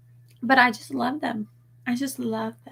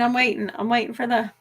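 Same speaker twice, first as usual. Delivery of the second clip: ordinary delivery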